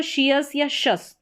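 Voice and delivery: "शियस" then "शस", said slowly; two readings of the ending. Shown as a demonstration. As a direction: give both endings, "शियस" with -ियस and "शस" short. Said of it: "दोनों तरीके से पढ़ा जाता है".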